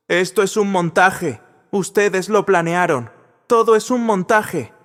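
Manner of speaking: a manager losing control before his guests, yelling; furious desperate yell. A narrator's reading. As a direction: rage out of control. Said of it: "alta, desesperada, furiosa".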